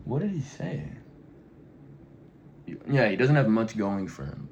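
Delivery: usual speaking voice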